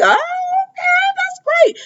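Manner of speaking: very high, drawn-out fake pleasantry; exaggerated high-pitched put-on reaction; mock congratulation. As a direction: stretch it out in a squeaky mock-polite voice. Acting out reaction to chairman news.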